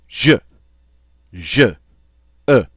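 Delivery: "je" cut short, never lingered over; brief explosive vowel. Shown as a demonstration. Teacher shows French learners the sound, brief and explosive. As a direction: say "je" and "e" as brief bursts. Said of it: "we don't linger over it".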